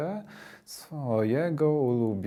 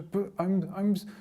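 retold stutter, higher at first; 8 semitones higher than usual. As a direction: stumble and block on the first words. Retells failed attempt to speak to idol. (jąkanie się przy próbie mówienia)

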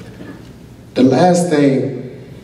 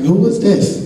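retold puzzled question; 9 semitones higher, slower than usual. Retold puzzled reaction to stranger.

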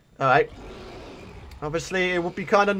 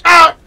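loud startled cry; 8 semitones higher, 14 dB louder than usual. Real, not acted. Taking damage in fight.

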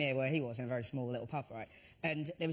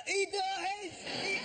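put-on high, slurred drunken heckle; drunk passerby; much slower than usual. Drunk man taunting the queue with spoilers. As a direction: shout it like a drunk wandering past. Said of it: "a minute later".

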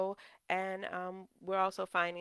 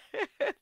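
high, loud little laugh; amused laugh; 6 dB louder than usual. Real, not acted. Preacher after fixing the slip, laughing. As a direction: laugh in relief at getting it right.

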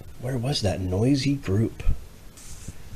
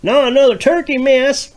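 high loud exasperated wife; farmer's wife; 7 dB louder than usual. Acted. Missus Farmer fed up with turkeys, high and loud. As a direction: groan it out high. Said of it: "Missus Farmer said".

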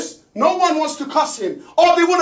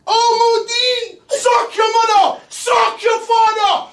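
higher, louder, slow yelled insults; shouting insults; 8 semitones higher, 4 dB louder, much slower than usual. Acted. Ranting guy screams insults at everyone, shouting. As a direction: bellow each one, half crying out. Ranting, being told to sit.

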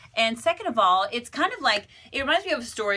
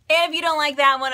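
usual speaking voice

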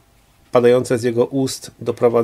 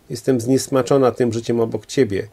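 ordinary delivery

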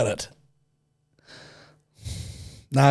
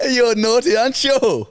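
teasing; 11 semitones higher, 4 dB louder than usual. Real, not acted.

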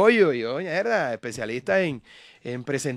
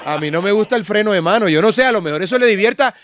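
loud, high-pitched teasing rant; raised voice, playfully outraged; mock indignant protest; 8 semitones higher, 12 dB louder than usual. Real, not acted. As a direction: fire the accusation back loudly. Mock protest, turning it on host.

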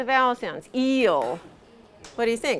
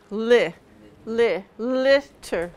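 slow syllable drill; much slower than usual. Shown as a demonstration. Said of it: "teacher slows word into syllables".

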